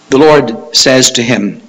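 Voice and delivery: ordinary talk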